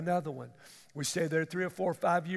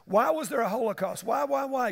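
higher, louder complaining questioner; mocking the doubter; 5 semitones higher, 5 dB louder than usual.

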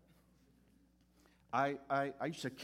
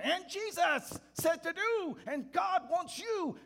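booming call; 10 dB louder, slower than usual. Retold or imitated. Voice of God breaking through.

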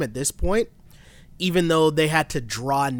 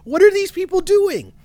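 much higher and louder exasperated cry; 6 dB louder than usual. Retold or imitated. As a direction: yell it in total disbelief at the players. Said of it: "I'm getting so mad".

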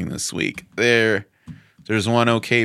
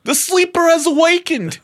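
much higher squeaky cartoon voice, louder; 5 dB louder than usual. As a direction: squeal the line out theatrically. Silly Dune quote bit.

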